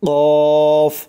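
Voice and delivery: Arabic qaf said thick; firm and thick. Shown as a demonstration. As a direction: hit কফ heavy and firm. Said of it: "শক্ত করে".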